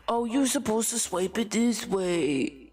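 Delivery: slow, drawn-out condescension; mocked Karen. Customer telling staff how to swipe, mock condescending. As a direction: imitate a know-it-all customer, dragging it out.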